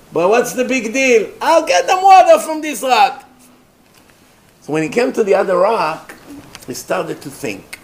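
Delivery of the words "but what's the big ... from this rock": loud, high-pitched defiant resolve; bold determination. Retold or imitated. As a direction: rise into a confident, booming vow. Acting Moshe defiantly taking the challenge.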